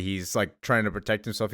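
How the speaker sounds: ordinary talk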